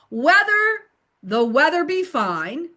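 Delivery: slow, careful "Whether the weather be fine", rising at the end; enunciated tongue twister. Shown as a demonstration. Tutor modelling a tongue twister for learners, enunciated. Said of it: "then I would have them do it".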